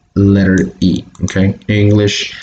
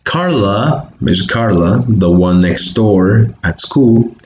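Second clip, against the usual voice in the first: ordinary talk, nothing marked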